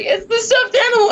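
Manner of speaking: very high, squeaky crying voice; mock tearful wail. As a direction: imitate yourself blubbering the answer. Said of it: "I'm, like, covered in tears".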